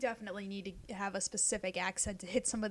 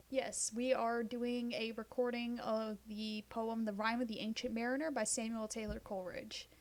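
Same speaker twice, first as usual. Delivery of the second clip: ordinary delivery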